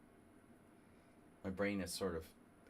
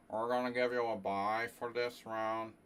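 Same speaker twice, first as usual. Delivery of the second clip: tournament director impression; 2 semitones higher, 6 dB louder than usual. Streamer imitates a famous tournament director handing out a bye, mock official. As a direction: announce it like a droning old tournament director. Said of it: "the most famous tournament director in the United States".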